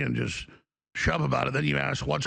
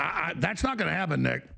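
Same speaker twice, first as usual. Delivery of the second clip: usual speaking voice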